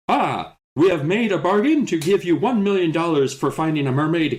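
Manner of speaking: high-pitched, hammed-up make-believe deal; mock childlike storytelling. Acting out the mermaid bargain as a child would, mock childlike. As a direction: declaim it like a child playing grown-ups. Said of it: "kind of feels like how a child would describe this".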